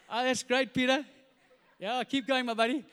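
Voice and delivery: loud shouted cheering-on; calling encouragement. Acted. John in the boat cheers swimming Peter, calling out. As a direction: shout casual encouragement over the water.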